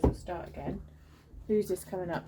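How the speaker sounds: ordinary delivery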